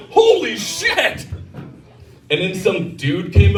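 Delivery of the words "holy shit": high, slow mock astonishment; hammed-up, drawn-out shock; shocked exclamation. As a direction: stretch it out high in disbelief. Onlooker reacts to the punch.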